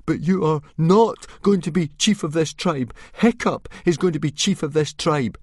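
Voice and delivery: put-on high, loud defiant boy voice; gasped defiance. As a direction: blurt it out bravely. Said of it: "managed to gasp out".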